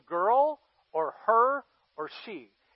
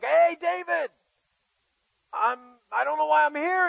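high, loud, innocent hello; pitched-up naive soldier; clueless friendly greeting; 9 semitones higher, 6 dB louder than usual. Acted. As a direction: play a puzzled guest hailing the king. Uriah greets the king, puzzled.

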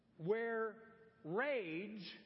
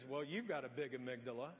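imitating wife; 9 semitones lower, 3 dB quieter than usual. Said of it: "she goes".

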